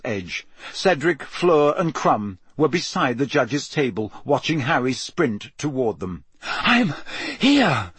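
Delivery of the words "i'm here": high, breathless broken announcement; panting. A narrator's reading. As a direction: get it out between pants. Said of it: "Harry panted".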